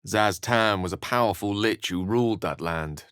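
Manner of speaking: ordinary delivery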